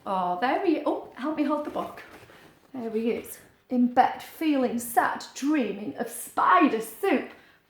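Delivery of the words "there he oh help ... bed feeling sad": plain reading voice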